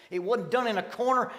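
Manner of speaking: Paul shouting his point in court; Paul's cry. Shown as a demonstration. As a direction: proclaim it with conviction. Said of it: "said as Paul".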